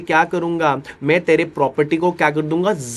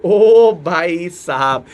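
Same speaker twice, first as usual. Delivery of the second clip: drawn-out call; 4 dB louder, much slower than usual. Shown as a demonstration. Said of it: "मैंने क्या बताया सुनना".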